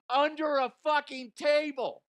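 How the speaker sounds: high, loud incredulous punchline; can't believe it, say it high; shocked reveal of the answer; disbelief. Real, not acted.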